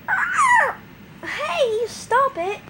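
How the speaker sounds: high startled shriek, then stop it; shriek of protest. Acted. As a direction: scream as the skull is ripped off. She shrieks, hurt.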